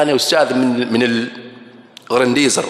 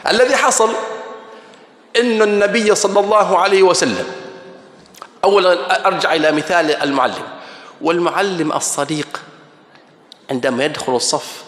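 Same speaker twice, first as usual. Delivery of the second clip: usual speaking voice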